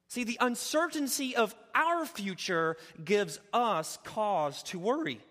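fired-up repeat of the opening line; preaching. Acted. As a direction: drive the refrain home from the pulpit. Refrain restated, preaching loud.

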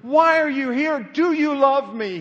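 loud, high, drawn-out demand; pleading challenge. Acted. The Lord asking worshippers about their love, loudly challenging. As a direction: throw the questions out hard, slowing on the last.

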